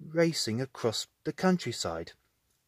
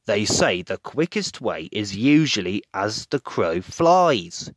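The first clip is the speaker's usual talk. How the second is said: Farmer Pickles voice; 5 semitones lower, 5 dB louder than usual. A narrator's reading.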